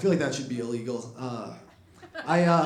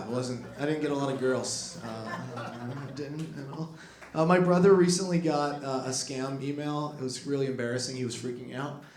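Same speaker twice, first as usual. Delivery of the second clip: ordinary talk, nothing marked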